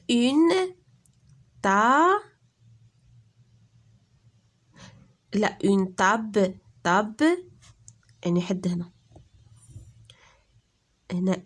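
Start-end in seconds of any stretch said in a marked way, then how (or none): 0.1-7.4 ta-ble détaché, plus fort; séparer table en syllabes pour les élèves; découpage syllabique. Shown as a demonstration. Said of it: "فيها deux syllabes". (تقطيع table بصوت مرتفع)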